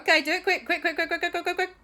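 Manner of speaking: high, rapid-fire repeated pleading; squeaky hurry-up chant; frantic urging. Acted. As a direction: rattle it off in a mock panic. Everyone urges him to hurry.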